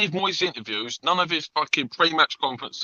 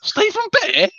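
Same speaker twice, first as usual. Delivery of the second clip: squeaky, much higher shocked "Stephen Bear?"; retold disbelief; 4 dB louder, slower than usual. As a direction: replay your own stunned reaction to the name. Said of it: "I went".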